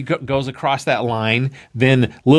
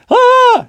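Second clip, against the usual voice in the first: loud, high mock gasp; startled cry; 6 dB louder than usual. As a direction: cry out in astonishment. Johnny gasps at the being.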